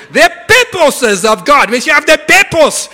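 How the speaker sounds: put-on high, loud preaching in Zambian accent. Zambian friend preaching on purpose, loud high Zambian accent. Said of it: "He's going".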